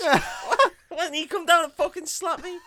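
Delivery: high-pitched comic grievance; outraged victim, played for laughs; mock indignation. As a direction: complain in hammed-up disbelief. Acting out slapped April's indignation.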